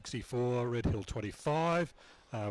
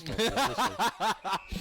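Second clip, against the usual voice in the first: laughing; 9 semitones higher, 4 dB louder, slower than usual. Real, not acted. Joking about fitness test.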